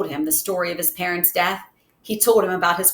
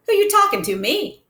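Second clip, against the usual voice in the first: mock tough; 13 semitones higher, 3 dB louder than usual. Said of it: "I'm just pulling his chain".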